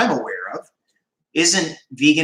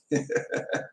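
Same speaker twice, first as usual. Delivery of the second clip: high breathy giggle; 11 dB quieter than usual. Real, not acted. Laughing at the chat comment.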